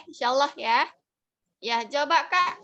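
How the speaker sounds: ordinary talk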